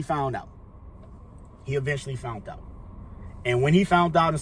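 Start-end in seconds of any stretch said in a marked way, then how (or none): 1.6-2.6 soft, sunken restatement; hushed repeat. Real, not acted. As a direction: let it drop away quietly for weight. Same words repeated, dropping away.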